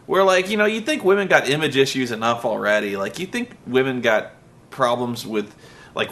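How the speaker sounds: ordinary delivery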